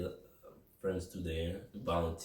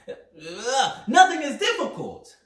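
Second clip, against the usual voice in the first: excited; 13 semitones higher, 7 dB louder, slower than usual. Real, not acted. High strained outburst.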